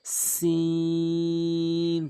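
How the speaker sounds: সিন held very long and slow; drawn-out letter name. Shown as a demonstration. Teacher reciting letter names for students. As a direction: stretch সিন across three beats. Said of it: "তিন আলিফ করে টেনে পড়বেন".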